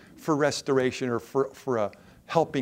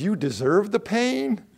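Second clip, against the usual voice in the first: a higher-pitched mock snarl; lightly voiced harsh dentist scolding a patient; mean angry dentist; 8 semitones higher than usual. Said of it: "hard, mean, angry dentist who says".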